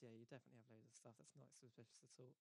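sarcastic. Retold or imitated. Marines doubting the robot's claims, quietly sarcastic. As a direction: toss it off, mocking.

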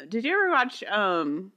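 ordinary talk, nothing marked

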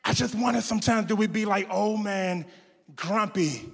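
quiet heartfelt preaching to the church; soft reflective preaching. Acted. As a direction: draw the congregation in quietly. Quiet reflective sermon application.

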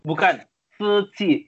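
"Suci" drawn out, syllable by syllable; slow correction. Retold or imitated. Correcting the misheard foundation name.

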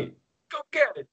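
high, hammed-up mobster voice; Italian-American accent. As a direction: bark it like a cartoon gangster. Joins the mobster bit.

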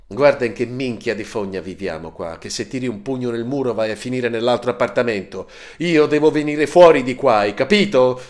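loud, high shouting. Acted. Marco yelling from his room: loud high shouting. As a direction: bellow it across the flat. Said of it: "continuando a strillare come un pazzo".

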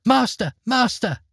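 high, sweet cry of alarm; a magic harp calling for its master. Acted. Harp calling her master as Jack steals her, high and sweet. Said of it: "cried the harp in her beautiful voice".

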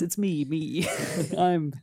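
ordinary talk

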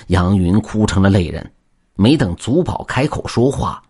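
ordinary delivery

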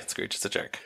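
a joking whisper, voice dropped right down; half-hidden whispered punchline; whispered aside. Real, not acted. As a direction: breathe it out as a whispered jab. Joking aside about Scrooge.

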